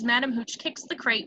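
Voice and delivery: ordinary talk, nothing marked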